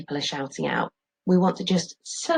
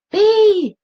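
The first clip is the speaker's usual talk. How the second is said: high, loud yell; Dad yells for her; 10 dB louder than usual. Acted. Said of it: "yells Dad".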